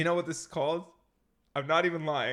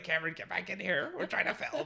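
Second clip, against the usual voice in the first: higher, light imitation of a partner calling in; mock scolding call; 5 semitones higher than usual. Imitating partner calling him back from the dog, mock scolding. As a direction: tease her calling you back inside, laughing. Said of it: "And Martine's like".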